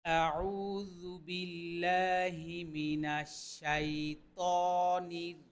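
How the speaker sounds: slow, drawn-out melodic recitation; Quran recitation. Shown as a demonstration. Teacher opens the lesson for students, slow melodic Quran recitation. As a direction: chant each word long and even, like tilawat. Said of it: "চলুন আমরা শুরু করি".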